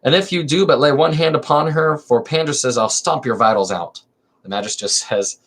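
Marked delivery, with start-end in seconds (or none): 0.0-4.0 lively theatrical reading of a verse comedy; dramatic play reading. Acted. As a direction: read the chorus lines with stage energy. Reads Callinicus.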